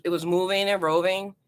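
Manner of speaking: usual speaking voice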